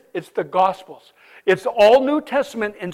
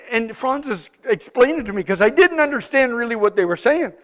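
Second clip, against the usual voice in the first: normal talking voice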